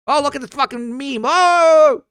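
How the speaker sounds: high, loud mock excitement; high mock squeal of excitement; mocking meme reaction. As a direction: ham up a giddy meme fan.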